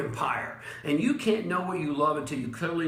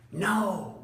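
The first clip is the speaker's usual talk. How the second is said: usual speaking voice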